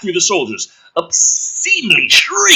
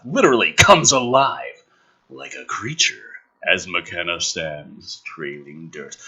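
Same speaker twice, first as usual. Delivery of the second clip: low ominous narration; 8 semitones lower than usual. Acted. Reading the father's arrival from the script, low narration. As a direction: murmur the scene with menace. Reads screenplay action aloud.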